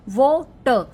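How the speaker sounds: वो ट drawn out slowly in two beats; split into syllables. Shown as a demonstration. Teacher repeating for students where the word breaks, वो ट slow and separated. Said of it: "फिर मैंने क्या बोला".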